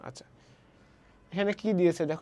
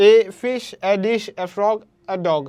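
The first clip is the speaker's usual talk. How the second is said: ফিশ, ডিশ, frog, ডগ punched loud after a light এ; stressed content words; 7 dB louder than usual. Shown as a demonstration. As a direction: lean hard on ফিশ, ডিশ, frog, ডগ, not on এ. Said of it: "এ কিন্তু stress word না". (এ হালকা রেখে ফিশ, ডিশ, frog, ডগ-এ জোর)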